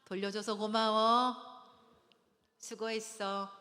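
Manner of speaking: slow, measured model of gratitude; demonstrated thanks. Shown as a demonstration. Coach modelling a wife thanking her husband for vacuuming, slow gratitude. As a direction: draw out the thanks for the class to copy. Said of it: "우리 자매들 따라합니다".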